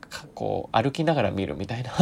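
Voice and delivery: ordinary talk, nothing marked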